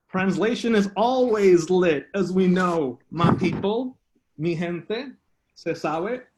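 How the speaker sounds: spoken-word poem with deliberate pauses; improvised poetry recital. Acted. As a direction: declaim it phrase by phrase, letting pauses hang. Performs improvised bilingual poem.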